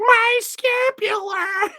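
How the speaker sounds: very high, drawn-out wail; mock agonized scream. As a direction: shriek it slowly in hammed-up pain. Acting out the injured creature.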